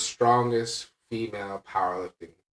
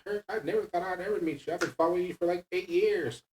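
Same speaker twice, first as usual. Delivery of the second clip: high-pitched imitation of an admirer; starstruck fan; 10 semitones higher than usual. Girl finally meeting the lifter in the gym, starstruck. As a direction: gush like a fan, pitched well up. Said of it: "She's like".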